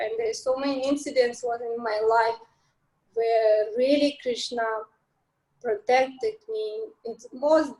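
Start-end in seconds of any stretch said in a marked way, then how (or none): none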